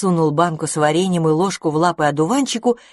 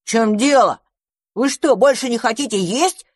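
surprised; 6 semitones higher, 3 dB louder than usual. Acted. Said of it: "удивился мышевраг".

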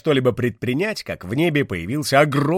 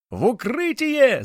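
high, slow alarmed shout; 12 semitones higher, 3 dB louder, slower than usual. Acted. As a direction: yell a warning to take cover. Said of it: "закричал он".

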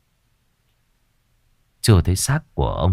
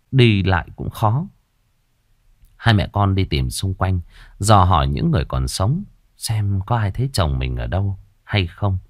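ordinary delivery

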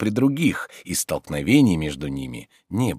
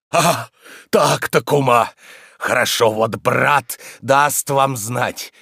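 resentful threat; 7 semitones higher, 5 dB louder, slower than usual. Acted. Thrown-out man threatens landlady.

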